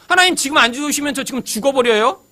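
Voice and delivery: half-joking threat thrown up at God; mock threatening. Preacher imagining himself bargaining in prayer, mock threat. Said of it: "이렇게 협박할 수는 없고".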